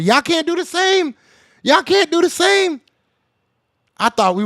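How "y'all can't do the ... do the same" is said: much higher, pressing the point; heated rant. Real, not acted. Streamer ranting at Cowboys fans, heated and high. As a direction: jab it at rival fans.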